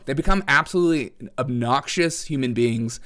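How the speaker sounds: ordinary delivery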